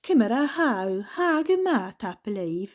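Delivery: a lightly sung Stornoway Gaelic lilt with small pauses; lilting Lewis Gaelic phrases; Stornoway Gaelic accent. Shown as a demonstration. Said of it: "It's very much like a sing-songy kind of voice".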